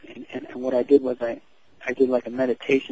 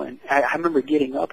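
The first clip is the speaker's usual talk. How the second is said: ordinary talk, nothing marked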